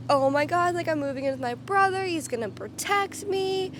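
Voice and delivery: mildly gushing, pitch lifting high; retold excitement. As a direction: mimic your naive excited self. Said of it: "I was so excited".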